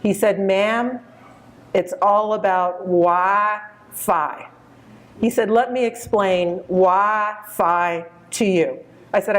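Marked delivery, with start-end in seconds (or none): 0.4-4.5 put-on slow, drawn-out Texas accent of a polite young salesman; Texas drawl. Young Texas clerk greeting a shopper, in a slow Texas drawl. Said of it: "he said in a nice Texas drawl". 5.5-8.8 put-on slow, drawn-out Texas accent of a polite young salesman; Texas drawl. Young Texas clerk greeting a shopper, in a slow Texas drawl. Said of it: "he said in a nice Texas drawl".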